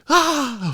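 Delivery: sudden high loud yelp of fright; startled high shriek; reenacted scream. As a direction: shriek like something just licked your leg. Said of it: "I was like".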